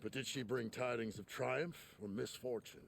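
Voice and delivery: measured storyteller narration; story narration. A narrator's reading. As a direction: set the scene like a fable narrator. Narrator frames the game scene.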